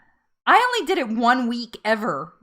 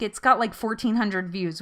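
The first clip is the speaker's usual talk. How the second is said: usual speaking voice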